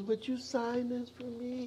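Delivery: lightly voiced timid child asking shyly; shy little boy. Boy asks Pete for an autograph, shy and soft.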